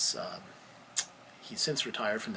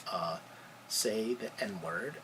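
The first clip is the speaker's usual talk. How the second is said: ordinary delivery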